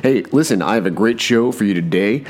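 normal talking voice

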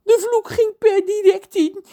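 high, loud tearful wail; sobbing. Acted. Cook John sobs about the curse to the children. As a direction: sob it out like a heartbroken cook. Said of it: "snikt hij".

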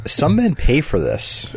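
slow deadpan. Pretend. Joking deadpan line.

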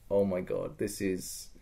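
low, soft aside; hushed dread. Retold or imitated. Group spotting cultists at the pond, hushed dread. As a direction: mutter it under your breath, foreboding.

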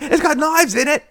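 very high mock British, laughing; British accent. Riffing on [name]'s British accent, high laughing British mimicry. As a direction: giggle through a hammed-up British accent. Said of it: "she's doing the British accent".

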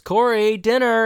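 mock yell, high and loud; retold yell. Amy calling Corey in to dinner, retold yell. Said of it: "Amy yells".

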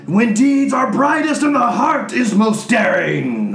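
high, loud, slow bellowed chant; rowdy shout. Acted. Frat boy Vikings answering the old man, loud roar. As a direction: roar it like a battle cry. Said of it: "they all shout".

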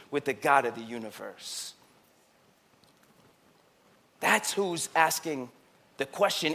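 quiet, close preaching; soft intimate preaching; hushed sermon. Real, not acted. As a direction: pull the voice way down.

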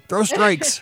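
high-pitched mock coaching yell; imitated yelling dad. Dad shouting at his kid pitching, imitated yelling. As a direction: playfully holler like a dad at the ballgame. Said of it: "that was my dad yelling at me".

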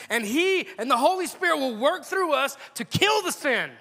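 high, loud, emphatic preacher's delivery; impassioned preaching. Real, not acted. Preacher urging congregation to return to Jesus now, impassioned. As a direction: drive it home with rising force.

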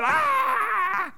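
long, high mock yell of pain; acted pain cry. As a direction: re-enact a drawn-out, high-pitched "aah". Re-enacting his reaction to injury.

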